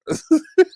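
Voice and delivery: shrill burst of laughter; high laugh. Real, not acted. Laughing at her own roast, high burst.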